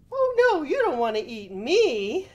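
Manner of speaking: high, loud goat protest; middle-sized billy goat. A narrator's reading. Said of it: "said the middle-sized billy goat".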